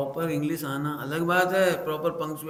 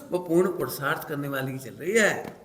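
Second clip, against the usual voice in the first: ordinary talk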